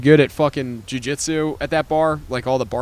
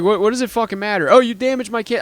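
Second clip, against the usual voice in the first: mocking whine; 8 semitones higher than usual. Imitating the whiny complaint.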